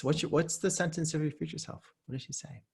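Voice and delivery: usual speaking voice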